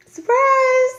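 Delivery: very high, loud sing-out; mock-cheery high-pitched reveal; nervous surprise. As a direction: squeal it while bracing for a hit. Retold nervous reveal to mother.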